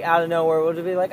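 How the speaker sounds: normal talking voice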